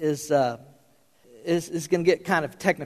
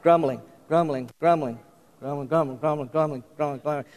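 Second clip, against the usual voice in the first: low, quiet muttering, word run over and over; mock discontented murmur, repeated continuously; better grumbling; 5 semitones lower, 4 dB quieter than usual. Shown as a demonstration. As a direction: grumble it under your breath, sinking softer. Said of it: "you can grumble better than that".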